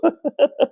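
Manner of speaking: a short high giggle slipping out; laughing in spite of himself; real laugh. Real, not acted. Lecturer breaking off mid-lecture in a high giggle. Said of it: "I'm laughing".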